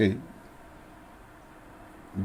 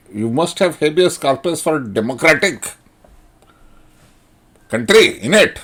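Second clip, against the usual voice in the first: high, loud, mocking imitation of a pompous lawyer; 7 semitones higher, 10 dB louder than usual. Mocking the lawyer he watched on YouTube, high and loud. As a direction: ham up a preachy "habeas corpus" lecture. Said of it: "He says".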